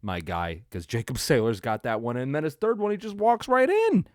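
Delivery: high, loud, hyped-up play call; rising excitement, almost yelling; excited. Real, not acted. Hyped replay commentary.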